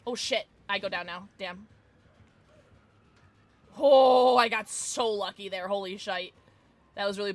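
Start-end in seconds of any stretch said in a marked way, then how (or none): none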